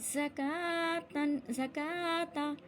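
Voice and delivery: slow, measured chant; recitation demo. Shown as a demonstration. Teacher showing where alif is silent, slow recitation. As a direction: draw out the long vowels. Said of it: "वाव को नहीं पढ़ा जाएगा".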